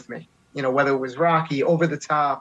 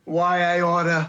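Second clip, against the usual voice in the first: slow, drawn-out New York tough guy; New York accent; 3 semitones higher, slower than usual. Pretend. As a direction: lean into the New York snarl. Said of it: "give me a".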